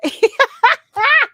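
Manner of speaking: cackling laugh; high loud burst of laughter. Real, not acted. Cracking up at the drunk dude's question, high loud laugh.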